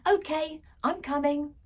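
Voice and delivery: high, loud call to another room; calling back. A narrator's reading. Girl calling back to dad upstairs, loud.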